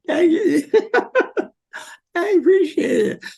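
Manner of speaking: overwhelmed, laugh and sob at once; laughing through tears. Real, not acted. [name] thanks the host for making him laugh, laughing through tears.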